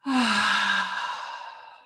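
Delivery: long open sigh out through the mouth; audible sigh. Shown as a demonstration. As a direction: let a big breath go with an "ah" sigh. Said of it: "sigh out through the mouth".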